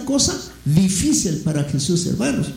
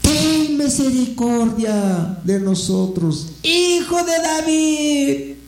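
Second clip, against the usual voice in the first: slow pleading cry rising higher at the end; 7 semitones higher, slower than usual. Retold or imitated. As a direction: call out for mercy, stretching the words. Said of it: "dando voces".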